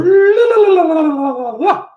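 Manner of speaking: high, loud playful blahs; squeaky mock sign chant; sing-song blah. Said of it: "It's going blah".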